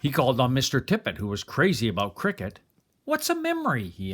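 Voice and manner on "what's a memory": put-on high, curious little boy voice; small boy. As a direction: ask it like a wide-eyed child.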